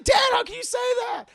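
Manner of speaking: very high, loud shocked protest; indignant shout. Retold or imitated. Son shocked father would abandon Elsa, indignant shout. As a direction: yelp it in mock indignation.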